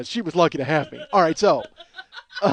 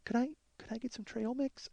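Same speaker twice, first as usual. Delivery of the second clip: tiny, higher, hushed voice; meek, very soft question; timid imitation; 4 semitones higher, 13 dB quieter than usual. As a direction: stammer it softly like a shy request. Imitating timid woman asking.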